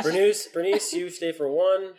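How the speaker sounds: urging a friend across the bar to stay, called out loud; calling out. Real, not acted. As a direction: call her over to stay for a drink.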